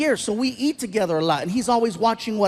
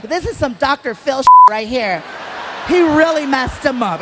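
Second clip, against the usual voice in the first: audience woman; 2 semitones higher, 5 dB louder than usual. Retold or imitated. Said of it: "She's like".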